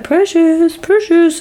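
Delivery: high, drawn-out mock call for a dog; high-pitched retold call. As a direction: imitate him calling out for his pet, pitched well up. Villain calling his dog, retold.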